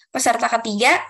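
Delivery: usual speaking voice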